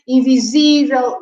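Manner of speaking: "Invisível" with the third syllable, "sí", leaned on; stress on accented I. Shown as a demonstration. Teacher explaining written accents, stress on the í of "Invisível". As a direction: hit the last i of "Invisível". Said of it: "put the accent on that I".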